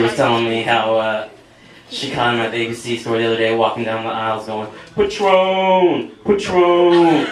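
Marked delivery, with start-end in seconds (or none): 5.0-7.3 loud, high drawn-out holler; long falling shout, hammed up; mock calling out. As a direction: imitate a man hollering down the aisles. Dad calling down store aisles.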